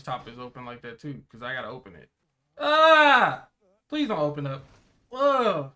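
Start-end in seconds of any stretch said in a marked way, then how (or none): 2.6-3.5 loud startled ah of disgust; disgusted cry. Real, not acted. Disgust at an open garbage bin. 5.1-5.7 disgusted groan. Real, not acted. Disgust at an open garbage bin.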